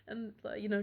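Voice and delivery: normal talking voice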